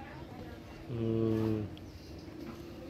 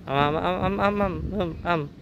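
nom nom; 7 semitones higher than usual. Pretend. Feeding the child, cooing.

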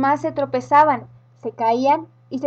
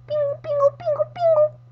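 very high squeaky pingu pingu; high squeaky wobble sound. A narrator's reading. Sound of shaking carrot noses.

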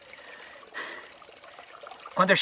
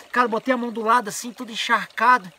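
ordinary delivery

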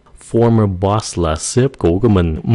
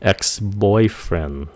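put-on Ex-boyfriend stretched very slowly; slow model; 4 dB quieter, much slower than usual. Shown as a demonstration. Teacher models ex-boyfriend slowly for learners. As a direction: draw out Ex-boyfriend gently. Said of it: "slow model word".

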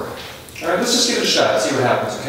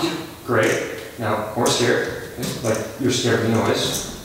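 ordinary talk, nothing marked

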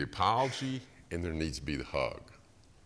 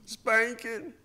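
put-on little child; 5 dB louder than usual. Child answers dad.

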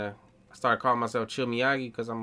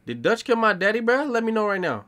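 much higher and louder demanding question; alarmed outburst; 10 semitones higher, 6 dB louder than usual. Real, not acted. Streamer insisting the game tell him who killed his dad, shouting. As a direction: demand the answer, worked up. Repeats the question, more worked up.

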